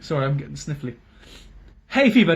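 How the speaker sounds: usual speaking voice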